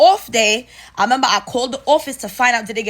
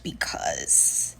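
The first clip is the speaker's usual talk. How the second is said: secretive whisper; 11 dB quieter than usual. Retold or imitated. New office lady leaking what the supervisor said, whispered.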